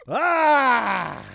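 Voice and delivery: sudden high pained cry; a sharp cry at bad news; anguished yelp. Real, not acted. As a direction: let out a real yelp of dismay. Reacting to bad injury news.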